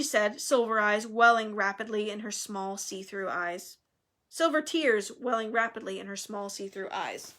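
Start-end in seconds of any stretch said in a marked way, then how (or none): none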